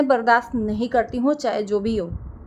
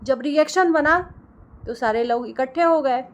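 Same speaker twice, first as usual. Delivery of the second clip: ordinary talk, nothing marked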